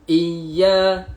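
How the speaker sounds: "Iya" chanted with a held double y; doubled ya. Shown as a demonstration. Teacher points out the sabdu, "Iya" with doubled y. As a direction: lean loudly on the y of "Iya". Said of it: "ada sabdu".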